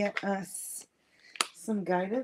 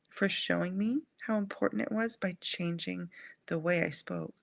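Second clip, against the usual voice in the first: ordinary delivery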